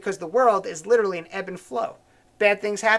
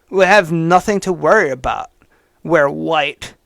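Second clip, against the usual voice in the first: mock hater's voice; 3 semitones lower, 6 dB louder than usual. Host reading a hater's post aloud, lower and louder in mocking voice. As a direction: sneer it out as the smug writer. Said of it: "read it in the voice I assume he wrote it in".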